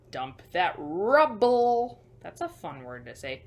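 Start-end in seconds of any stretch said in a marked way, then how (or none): 0.1-2.0 louder, slow drawn-out command; slow loud call-out. Acted. To the children, a slow, loud construction order. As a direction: bellow it slowly like a site boss.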